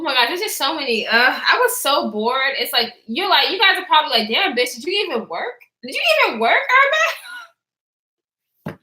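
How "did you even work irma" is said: higher, laughing through the repeat. Retold or imitated. Imagined viewers teasing her, laughing. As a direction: crack up while repeating the tease.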